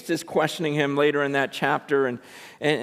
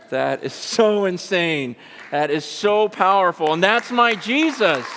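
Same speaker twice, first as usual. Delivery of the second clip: building to high-pitched fervor; marveling sermon climax, pitched up; impassioned preaching; 11 semitones higher, 3 dB louder than usual. Real, not acted. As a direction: exult in awe before the congregation. Preaching at full force.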